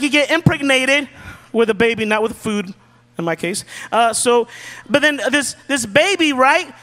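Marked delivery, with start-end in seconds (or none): none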